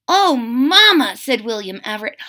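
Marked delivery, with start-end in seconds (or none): 0.1-1.2 put-on slow, high, drawn-out child; a kid bursting with eagerness; excited young crocodile. William answers mama about school, high eager child.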